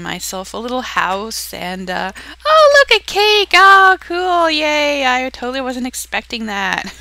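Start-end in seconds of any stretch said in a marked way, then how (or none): 2.5-6.9 hammy fake surprise, much higher and louder; bad acting. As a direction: overact delight at finding a cake. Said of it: "I'm a horrible actress".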